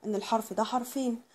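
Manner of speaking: ordinary delivery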